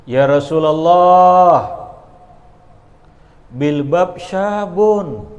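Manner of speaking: loud, slow, drawn-out plea; Umar's loud plea. Acted. Umar answering the Prophet about the youth, loud slow plea. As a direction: cry it out slowly to the Prophet. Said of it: "ujar Umar".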